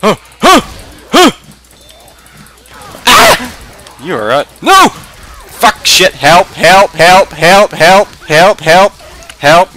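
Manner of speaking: high panicked cries for help; screaming in fear. Real, not acted. Being attacked, calling for help.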